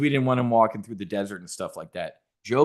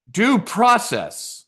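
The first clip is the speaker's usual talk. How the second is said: mocking drawn-out phrase; 9 semitones higher, 4 dB louder, much slower than usual.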